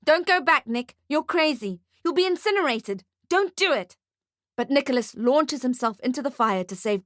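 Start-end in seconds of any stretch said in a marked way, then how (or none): 0.0-4.0 high, urgent warning; frantic plea to turn back; shouting. A narrator's reading. Ferdy begs Nick not to return to fire, shouting. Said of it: "Ferdy shouts at Nick".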